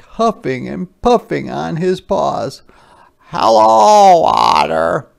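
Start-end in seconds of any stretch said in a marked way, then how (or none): 3.3-5.1 higher, louder hedgehog call. Acted. Hedgehog calls out a loud hello to Otter. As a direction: call across the riverbank. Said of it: "shouted Little Hedgehog".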